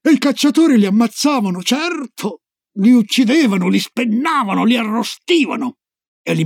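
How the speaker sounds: high, mounting excited list; animated storyteller's crescendo; gleeful gruesome relish. Acted. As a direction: swoop up on each grisly verb. Grandma relishing a gruesome list to child.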